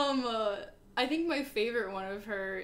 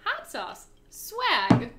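Hillary impression; 2 semitones higher, 6 dB louder, much slower than usual. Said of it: "if she just went".

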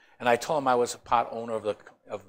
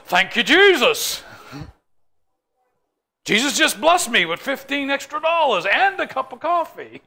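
higher, louder, with a slow start; mock gleeful thanks; 11 semitones higher, 7 dB louder than usual. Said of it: "would go".

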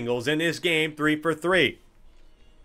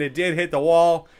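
usual speaking voice

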